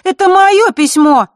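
high, loud, slow indignant cry; indignant outburst. Acted. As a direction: protest hotly that it's yours. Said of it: "возмутилась Захара".

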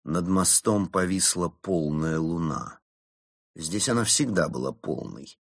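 usual speaking voice

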